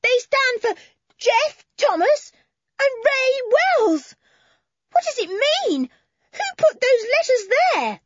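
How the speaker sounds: put-on boy shouting as he decodes the names; excited shout. A narrator's reading. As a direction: shout it out in amazement. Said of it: "Then it rose to a shout".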